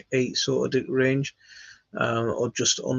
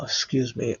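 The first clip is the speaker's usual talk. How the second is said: usual speaking voice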